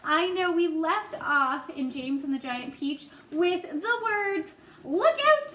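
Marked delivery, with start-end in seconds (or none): 4.8-5.5 high, loud warning cry; urgent shout; cried out. Acted. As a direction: yell a warning to a friend. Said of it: "cried James".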